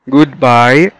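usual speaking voice

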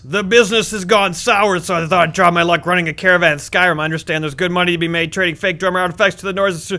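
loud put-on gruff voice for the book's character. Reading the Axe Man's note aloud, loud gruff voice. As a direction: read it like the hulking axe man.

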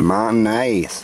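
mayonnaise tried as "mah-naze"; mayonnaise with the middle syllable dropped; imitated pronunciation. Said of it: "Learn how to say it".

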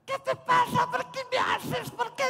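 put-on very high squeaky girl voice; mock little girl shouting. Little Carlita fighting Geri, squeaky and high.

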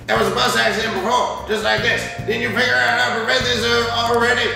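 high, insistent complainant voice; indignant questioner. Acted. Complaining to an official about repeated accidents, high indignant voice. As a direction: hold the pitch up and press the accusation.

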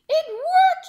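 high, loud triumphant crab; shouted. Acted. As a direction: crow with delight. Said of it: "he shouted".

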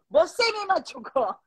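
slow broken Spanish, Brazilian lilt, half laughing; imitated Portuguese speaker. The boyfriend yelling his complaint, in mock Portuguese accent. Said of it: "le empieza a gritar".

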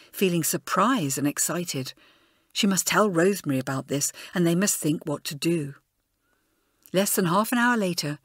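ordinary delivery, nothing marked

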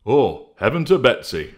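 Honesty at his trashed bedroom, loud and dismayed; crying out. Acted. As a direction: cry it out in dismay. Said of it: "cried Honesty".

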